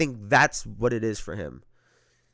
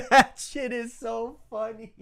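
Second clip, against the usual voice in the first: slow, through laughter; giggling and amused; laughing; 7 semitones higher, slower than usual. Real, not acted. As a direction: crack up while saying it. Laughing at the video.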